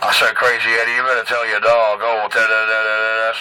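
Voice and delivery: deep taunting singsong; low mocking voice. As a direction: drop low and mock him. Low mocking chant at rival.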